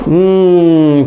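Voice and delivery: usual speaking voice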